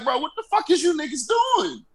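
high, exasperated yelling; incredulous shout. Retold or imitated. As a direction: yell it in disbelief. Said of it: "I was like".

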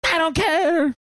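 high shouted tantrum; yelling. Acted. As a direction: yell it like a bratty kid. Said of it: "Guttersnipe yelled".

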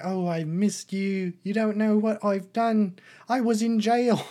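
high, loud mock confession; imagined Paddington. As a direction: pour it out in a hammed-up bear voice. Imagines Paddington confiding in his aunt.

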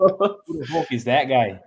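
laughing while repeating the joke; laughing echo. Real, not acted. Echoing his friend's joke about a photo, laughing. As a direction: toss the line back, laughing.

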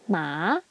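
"Ma" starting low and going up; rising second tone. Shown as a demonstration. Teacher repeating second tone, "Ma" rising. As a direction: slide "Ma" upward. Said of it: "going up tone".

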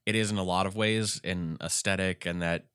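normal talking voice

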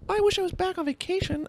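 very high put-on falsetto; mock pining. Traveller back home after a huge trip, mock pining. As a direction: mimic wistful complaining in a squeaky high voice.

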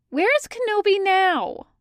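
high, insistent question; demanding. A narrator's reading. Boba demands to know where Kenobi is, high and insistent. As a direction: demand an answer, voice pitched right up. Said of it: "Boba demands".

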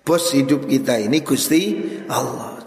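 ordinary talk

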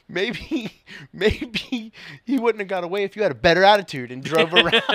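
high, loud, laughing mock of a snide woman; mocking driver's voice. Mocking the driver who blamed his attitude, high and laughing. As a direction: ham up her smug jab through laughter. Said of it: "she was like".

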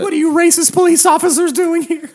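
high, loud mock outrage; hysterical girlfriend imitation. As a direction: scream at the police as the girl. Voicing girl yelling at police.

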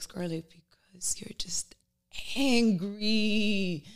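unvoiced whisper to herself; whispered self-talk. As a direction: breathe the words to yourself, slow. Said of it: "I was like".

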